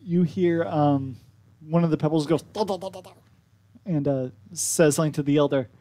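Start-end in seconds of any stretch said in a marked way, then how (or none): 2.4-3.1 a lightly voiced pebble babble; creature gibberish. Pebble chatters to the elder, soft babble.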